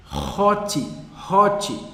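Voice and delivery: hot said with the H as a rough R sound, RO-t. Shown as a demonstration. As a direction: breathe the h of hot like a throaty R. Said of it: "como se fosse com som de r".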